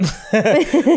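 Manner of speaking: a real, bright burst of laughter. Real, not acted. Laughs at wife's "in it.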